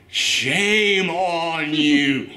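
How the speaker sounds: drawn-out, scolding each word slowly. Real, not acted. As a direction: shame him slowly, word by word. Ranting at the film's producer.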